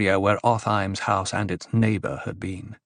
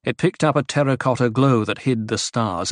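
plain reading voice

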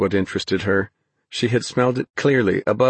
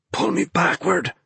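strained, high-pitched grunt; grunted with effort; 9 semitones higher than usual. A narrator's reading. Ben to Naomi, hauling the dog off the ice, strained grunt. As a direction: grunt it out while hauling a weight. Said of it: "he grunted".

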